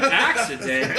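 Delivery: "Accident?" in a different, half-laughing voice; mock surprise. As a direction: echo "Accident?" back in disbelief. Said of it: "And I went".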